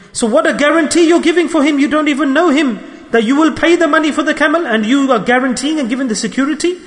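high-pitched, disbelieving questioning; incredulous challenge. Retold or imitated. Tribesmen question the wife's guarantee for a stranger, incredulous. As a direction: act out protesting, astonished tribesmen.

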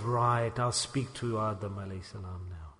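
very low, hushed devil's aside; scheming undertone. Acted. Shaitan plots to approach Adam, in a low hushed voice. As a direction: keep it low and secret, almost to yourself.

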